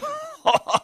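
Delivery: rire aigu et éclatant; gaieté formidable; grand éclat de rire. Acted. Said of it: "à se tenir les côtes". (Patrice éclate de rire, aigu)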